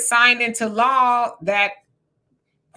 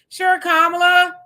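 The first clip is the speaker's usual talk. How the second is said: put-on high, loud, vague agreeing old man; loud mock Biden reply; 8 semitones higher, 5 dB louder than usual. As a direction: ham up a clueless yes.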